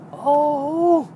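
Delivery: high delighted oh; sudden cry at something cute; surprised gasp. Real, not acted. Spots a kitten while driving.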